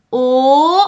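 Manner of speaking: «او» as a long, loud rounded back o; plain O vowel. Shown as a demonstration. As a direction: hold a clear back o on «او». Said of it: "این او بود". («او» کشیده و بلند مثل o انگلیسی)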